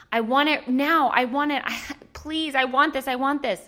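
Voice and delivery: put-on the inner toddler nagging for a treat; whiny toddler. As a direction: plead like a kid who wants candy now. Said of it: "conversation in your head".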